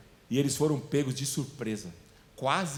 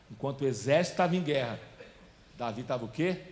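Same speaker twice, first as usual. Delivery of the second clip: ordinary talk, nothing marked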